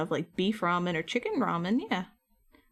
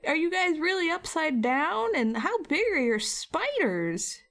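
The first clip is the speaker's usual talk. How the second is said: slow, high, swooping questions; mock dumb wonder; 7 semitones higher, slower than usual. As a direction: ask like a naively awestruck tourist. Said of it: "we got stupid questions like".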